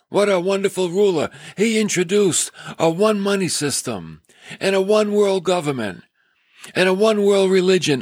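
high, hammed-up adoration; happy crowd, mocked. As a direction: gush like a delighted follower, in mockery. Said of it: "everybody was so happy".

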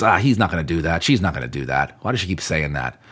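low, lightly acted doubting aside; understated, dismissive inner critic; skeptical inner voice. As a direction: grumble it low, as if doubting someone's promise.

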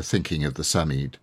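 usual speaking voice

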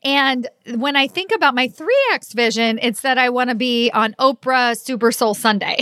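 ordinary talk, nothing marked

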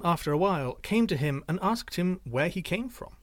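ordinary delivery

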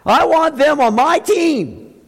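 hammed-up delight at spotting a star recruit; mock amazed Jesus. Preacher mocking a Jesus who picks the shining ones, amazed. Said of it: "Jesus will walk by, and he'll go".

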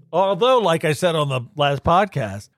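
ordinary talk, nothing marked

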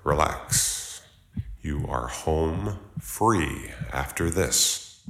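slow, soft self-reassurance; calming self-talk. Real, not acted. Driver calming himself before the roadblock, slow and soft. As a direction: breathe out and steady yourself. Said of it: "took a deep breath and told myself".

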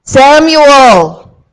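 booming urgent call; exclaimed call. Acted. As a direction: shout Samuel with exclamation points. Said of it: "And there's exclamation points".

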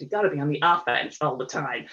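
mock American accent, firm advice; bad American accent. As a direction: imitate an American mentor's accent, badly. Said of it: "do my terrible American accent".

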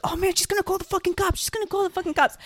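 mock panic, high-pitched and rushed; freaking out. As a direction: imitate someone panicking, high and fast. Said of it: "some of the people freaking out".

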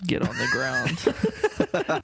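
high, drawn-out mock police command; hammed-up TV cop; Cops line. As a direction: imitate a cop's order, stretched out. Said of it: "famous line from Cops".

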